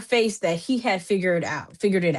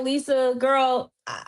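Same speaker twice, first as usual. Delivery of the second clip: normal talking voice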